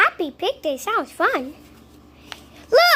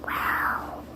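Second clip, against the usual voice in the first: a hushed, amazed wow; awed whisper; 12 dB quieter than usual. Acted. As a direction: breathe the word out in wonder. Awed reaction in the story.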